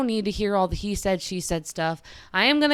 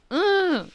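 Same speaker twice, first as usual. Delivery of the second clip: exasperated ugh; 11 semitones higher than usual. Real, not acted. Frustrated groan mid story.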